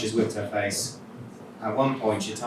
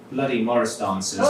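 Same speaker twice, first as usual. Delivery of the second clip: ordinary delivery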